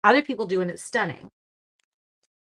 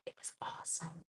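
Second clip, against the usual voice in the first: whispered aside; soft unvoiced whisper; 20 dB quieter than usual. Real, not acted. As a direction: breathe it out unvoiced.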